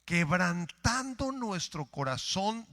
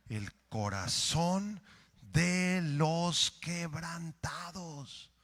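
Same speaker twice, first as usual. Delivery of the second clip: slow solemn reading; much slower than usual. Acted.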